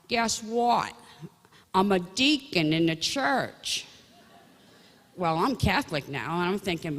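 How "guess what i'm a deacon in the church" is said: higher, slow Pennsylvania drawl, proud; proud news in a Pennsylvania accent. Retold or imitated. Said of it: "he's got that Pennsylvania accent".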